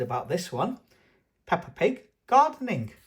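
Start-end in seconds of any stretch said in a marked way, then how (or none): 1.5-2.9 title announcement. Acted.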